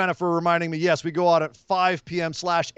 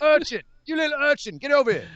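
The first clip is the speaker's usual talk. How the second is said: high, amused Cockney scolding; Cockney accent; 8 semitones higher than usual. Pretend. Summoning an imaginary urchin, mock Cockney. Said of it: "Automatically Cockney".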